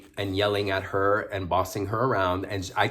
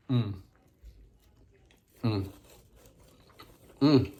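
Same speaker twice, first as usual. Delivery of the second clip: savoring hum. Real, not acted. Tasting the meatball sub.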